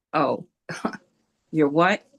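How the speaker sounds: ordinary delivery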